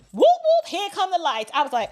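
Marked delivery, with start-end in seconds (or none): 0.1-0.7 high, loud whooping siren; imitated police siren. Police lights come on behind the car, imitated siren whoop.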